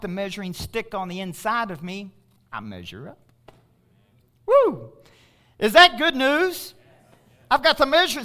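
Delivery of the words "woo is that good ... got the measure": loud joyful preacher cry; exultant shout. Real, not acted. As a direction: whoop it up for the crowd. Preacher rallies congregation.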